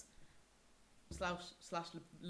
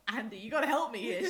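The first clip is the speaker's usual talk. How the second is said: loud, exasperated appeal through laughter; laughing plea; 3 semitones higher, 10 dB louder than usual. Retold or imitated. Baffled friend asking Andy to translate, laughing plea. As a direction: beg for help while laughing.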